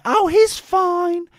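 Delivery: high mocking impression of the nurse. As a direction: mimic her as a smug dozy nurse. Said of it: "because of this dozy mayor".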